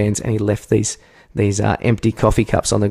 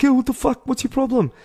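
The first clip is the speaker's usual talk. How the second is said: much higher, strained confrontational call; retold angry shout. Driver who nearly hit him, angry high shout. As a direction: imitate a driver yelling at a runner.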